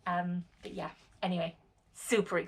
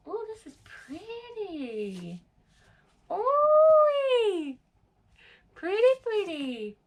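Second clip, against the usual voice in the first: delighted cooing; 14 semitones higher, 7 dB louder, much slower than usual. Real, not acted. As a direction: swoon over the pretty cover, then squeal. Admiring the new book.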